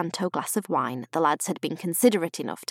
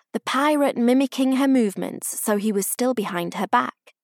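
usual speaking voice

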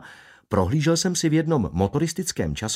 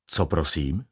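ordinary delivery, nothing marked

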